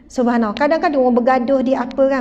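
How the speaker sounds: normal talking voice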